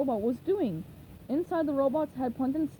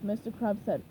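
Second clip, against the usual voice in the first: plain reading voice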